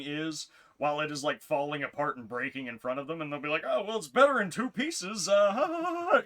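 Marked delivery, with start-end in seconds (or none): 3.7-6.2 high, forced fake laugh; imitating relentless praise with a louder fake chuckle; mock upbeat reviewer. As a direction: cover disaster with loud, high cheer. Imitates reviewer praising a broken product.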